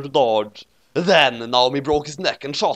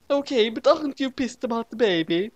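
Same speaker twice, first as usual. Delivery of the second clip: high voiced Ray; 11 semitones higher than usual. A narrator's reading. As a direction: drag it out in a high silly voice.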